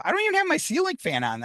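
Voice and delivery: high-pitched, quick, bewildered protest; baffled disbelief. Retold or imitated. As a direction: retell being utterly puzzled, voice up high. Baffled by the moving pages.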